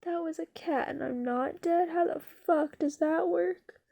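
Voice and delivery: loud mock disbelief, dragging out the key words; slow, loud, incredulous storyteller's outburst; dumbfounded. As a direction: act out a stunned mouse, baffled and emphatic. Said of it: "like, dumbfounded".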